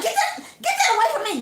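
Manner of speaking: laughing, spooked high recoil; fearful recoil. Retold or imitated. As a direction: shove it away in fright. Sister recoils from photo.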